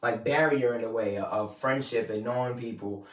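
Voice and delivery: usual speaking voice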